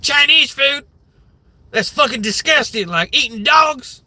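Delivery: high, put-on hillbilly accent, disgusted; mock hillbilly outrage. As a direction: whine it like a cartoon hillbilly. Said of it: "all these different kinds of hillbilly voices".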